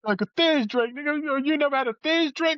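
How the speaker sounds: hyped-up inmate. Retold or imitated. Inmates pushing the Thizz drink.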